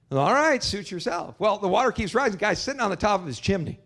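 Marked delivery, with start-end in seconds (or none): none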